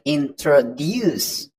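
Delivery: "introduce" with the last syllable stressed, in-tro-DUCE; stress at the end. Shown as a demonstration. Teacher demonstrating word stress, "introduce" stressed on its end. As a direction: lean on the final "duce" of "introduce". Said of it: "pronounced with a stress at the end".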